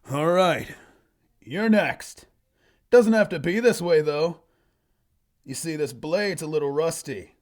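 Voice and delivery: swaggering fantasy fighter making a threat; cocky loner hero. Acted. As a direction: call out the bandit chief boldly. Voices the loner threatening bandit.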